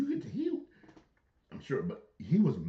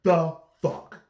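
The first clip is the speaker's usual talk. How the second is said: loud, higher the fuck; repeat on request; 7 semitones higher, 11 dB louder than usual. Pretend. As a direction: punch it out again on request. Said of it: "Gotta say it one more time".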